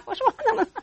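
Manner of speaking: high mock-indignant comeback amid laughter; defensive retort. Mother has no answer to child, high defensive. 因为当时你不知道怎么回答.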